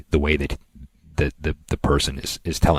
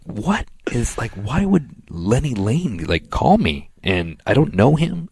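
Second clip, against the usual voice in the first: mildly acted puzzlement, pitch rising; retold bafflement; 8 semitones higher, slower than usual. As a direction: replay being baffled by the call. Retelling his baffled reaction to call.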